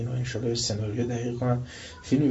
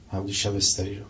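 ordinary talk

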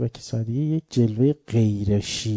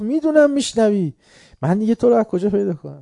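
much higher, loud exasperated outburst; angry retort; 9 semitones higher, 4 dB louder than usual. Retold or imitated. Cleric snapping back at the shah, high and loud. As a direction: fire it back at him in rising anger.

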